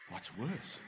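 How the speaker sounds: soft, puzzled echo of the question. Retold or imitated. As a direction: repeat it quietly to yourself, baffled. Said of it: "And I thought".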